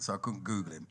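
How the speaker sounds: ordinary talk, nothing marked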